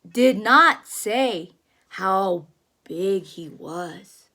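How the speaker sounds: booming storybook whale; big whale voice. Acted. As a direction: play the big whale bragging about his size. Reading the big whale's lines.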